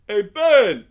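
calling out loud and high; retold yell. Man yells to storyteller from the end of the alley, loud call. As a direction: imitate a long-distance holler. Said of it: "he yells it from the end of the alley".

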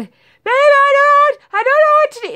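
wife calling husband in distress, high and loud; panicked plea. Retold or imitated. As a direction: beg for help like it's a crisis. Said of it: "so you're the".

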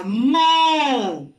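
"mo" stretched out for learners; one long, deliberate "mo"; sílaba modelo. Shown as a demonstration. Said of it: "sílaba". ("mo" con la vocal alargada)

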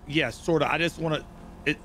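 ordinary talk, nothing marked

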